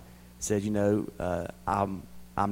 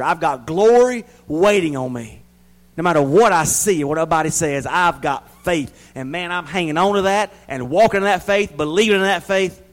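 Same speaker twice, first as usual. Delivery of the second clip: preaching at full force; 5 semitones higher, 8 dB louder than usual. Acted. Preacher crying out faith to the church, loud and high. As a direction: lift the church with every line.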